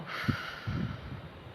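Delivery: long soft exhale; releasing breath. Shown as a demonstration. Leading students through a breathing exercise, long releasing sigh. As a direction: let the air sigh out slowly. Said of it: "Let it go".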